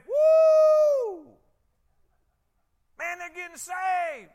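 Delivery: huge high whoop, then loud slow celebrating; joyful whoop. Retold or imitated. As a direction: shout for joy at the news. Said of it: "instead of saying".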